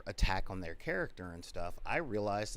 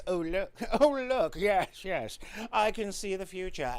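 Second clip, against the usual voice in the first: high, lofty snooty elf; hoity-toity elf voice; 7 semitones higher, 5 dB louder than usual. Acted. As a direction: play a smug, superior elf. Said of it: "do my hoity-toity, um, elf voice".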